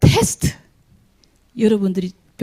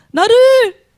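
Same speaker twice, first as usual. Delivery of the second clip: pleading cry; 11 semitones higher, 7 dB louder, much slower than usual. Retold or imitated. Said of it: "또 뭐라 했어요".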